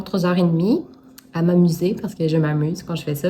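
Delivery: ordinary talk, nothing marked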